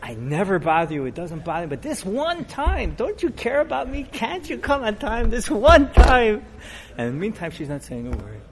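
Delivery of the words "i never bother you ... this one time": exasperated plea. Retold or imitated. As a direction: beg her, at the end of your rope. Husband pleads angrily with stalling wife.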